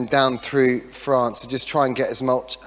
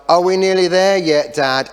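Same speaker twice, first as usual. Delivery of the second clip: mock kids; 4 semitones higher, 5 dB louder than usual. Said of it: "They're saying things like".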